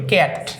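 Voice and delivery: ক্যাট said as keʈ, the a like the e in cat; ক্যাট pronounced keʈ, not kat; pronunciation demo. Shown as a demonstration. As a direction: say ক্যাট with an open e sound, quietly. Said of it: "এটার উচ্চারণটা আমরা কি করি?".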